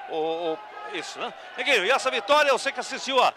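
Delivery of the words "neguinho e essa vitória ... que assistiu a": louder, higher-pitched reporter's question; calling out. Real, not acted. Reporter asks Neguinho about the win, calling out. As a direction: call out the question with raised voice and pitch.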